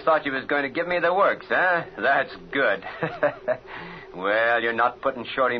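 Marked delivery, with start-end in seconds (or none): 2.0-3.6 mocking chuckle; gloating laugh. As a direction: laugh at them smugly. Gangster gloats at captives.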